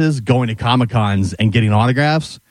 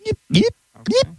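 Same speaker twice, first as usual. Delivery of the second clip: alien voice; 8 semitones higher than usual. Pretend. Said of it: "that's how they talk".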